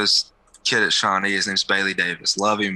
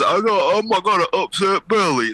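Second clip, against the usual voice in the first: mock whiny Jordan; 8 semitones higher than usual. Mocking Jordan's excuse.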